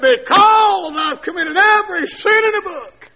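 high, loud preacher's holler; shouted confession. Retold or imitated. Husband answering his screaming wife, shouting. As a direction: shout the retold answer at full voice.

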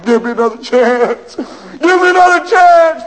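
high weeping begging; crying plea. Acted. Prisoner crying while carried to the chair. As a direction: plead as they carry him. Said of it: "could still hear him crying".

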